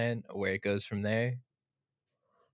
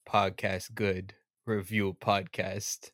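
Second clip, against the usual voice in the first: caveman talk; 5 dB louder, slower than usual. Pretend. Caveman-style sign-off.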